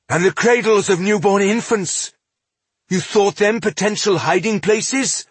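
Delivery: high-pitched outraged questioning; furious exclamation. A narrator's reading. Elf challenges dwarf over slain infants, furious. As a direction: fling the accusation as an outraged question. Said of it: "Estelin exclaimed furiously".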